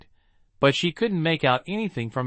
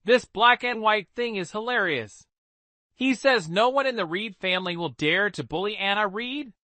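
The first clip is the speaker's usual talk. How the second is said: mocking; 7 semitones higher than usual. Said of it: "burst into mocking laughter".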